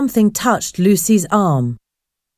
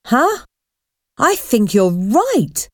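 exclaimed; 4 semitones higher than usual. A narrator's reading. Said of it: "exclaimed Paul".

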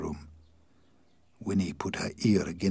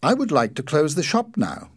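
higher, louder woman's character voice; Winnie, polite shopkeeper; 8 semitones higher, 8 dB louder than usual. A narrator's reading. Shopkeeper wife to visiting inspector about closing, polite raised voice. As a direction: lift the voice for a courteous shopkeeper.

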